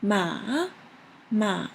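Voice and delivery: bend "Ma" down and back up; third tone. Shown as a demonstration. Teacher matching the "well" example, dipping-rising "Ma". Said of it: "The third one is".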